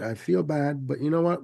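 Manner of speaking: ordinary delivery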